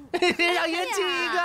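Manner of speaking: slow, high, laughing leer; lecherous drunk teasing. Acted. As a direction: drawl it through a laugh.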